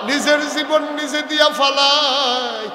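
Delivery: building from plain call to high, loud, slow sung wail; mother screams for her own mother in labour; crying out. Acted. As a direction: let the mother's cry swell into a shout. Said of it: "চিল্লান দেয়া কয়".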